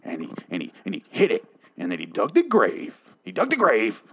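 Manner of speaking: swooping, theatrical movie-character voice; hammed-up oilman impression; Daniel Plainview. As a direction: declaim it like a grim film monologue. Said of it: "describe it like, uh, William".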